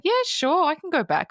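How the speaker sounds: high-pitched, easy yes; breezy agreement. Retold or imitated. Acted breezy agreement in retelling.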